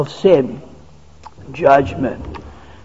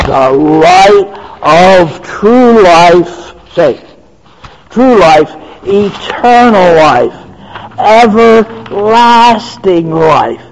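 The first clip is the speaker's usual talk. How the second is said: rising, louder preacher cadence, slow on the quotes; preaching at full force; 5 dB louder than usual. Acted. Preacher proclaims to the congregation, fervent and rising. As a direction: build the list like a pulpit crescendo.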